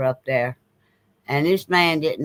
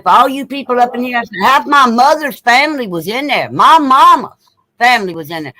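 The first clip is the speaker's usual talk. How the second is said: loud, higher, addressing the whole room; speaking out firmly to the mourners; retelling how she called out to the funeral crowd; raised voice; 5 semitones higher, 7 dB louder than usual. Retold or imitated. Standing up at the funeral addressing everyone.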